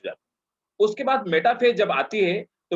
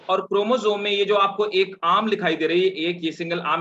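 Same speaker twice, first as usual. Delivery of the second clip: ordinary talk, nothing marked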